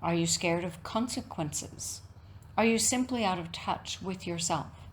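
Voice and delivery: ordinary delivery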